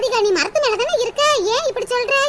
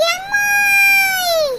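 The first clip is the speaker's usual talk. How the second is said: long crying call; 6 semitones higher, much slower than usual. Acted. Child cries out from treetop.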